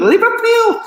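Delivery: very high, loud mock Scouse; hammed-up Liverpudlian shout; terrible Liverpool accent. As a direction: attempt a squealed Liverpool accent, badly. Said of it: "So that's a terrible accent, I know, but I tried".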